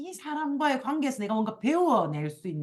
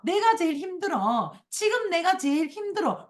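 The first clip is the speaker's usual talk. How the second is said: high-pitched mock protest; mock insistent complaining; 7 semitones higher than usual. Insisting they have it hardest, mock insistent complaining. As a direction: imitate someone stubbornly pleading their hardship. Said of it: "그걸 그렇게 계속 우긴다니까요".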